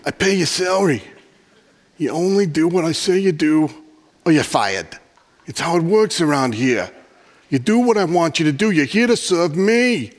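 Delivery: mock a bossy Southerner who pays your salary; Southern accent. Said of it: "I ended up going to, like".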